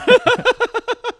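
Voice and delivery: squealing laugh; a high burst of laughter; cracking up high. Real, not acted. Laugh at apple eating.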